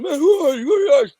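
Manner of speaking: high, loud mock-panicked yell; imitated yelling. Man dragged down the hall by his hair, imitated high yelling. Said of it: "He's yelling".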